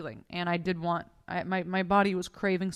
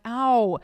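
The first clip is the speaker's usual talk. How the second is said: louder imitated yelp of pain; drawn-out mock cry of pain; 3 semitones higher, 7 dB louder than usual. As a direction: act out a loud ow. Retelling her cry of pain.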